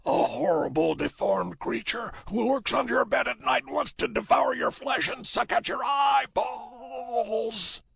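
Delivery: very high, loud, hammed-up demon roar; mock demonic voice. A narrator's reading. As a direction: roar like a hideous monster, stretching the last word. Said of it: "spoke in a mock demonic voice".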